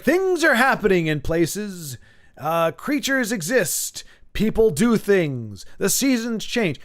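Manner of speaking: slow parody of portentous writing; mock vague summary. Host parodies an ambiguous plot summary, slow mock gravity. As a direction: spell out each empty statement slowly, mockingly. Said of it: "it's being very ambiguous. It's like".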